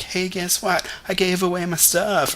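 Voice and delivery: high bright mock announcement; mock cheerful announcement. Pretending to cheerfully tell friends, perky mock announcement. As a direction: chirp it out as a pretend boast.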